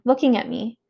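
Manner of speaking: usual speaking voice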